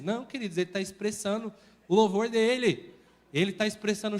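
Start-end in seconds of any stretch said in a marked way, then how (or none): none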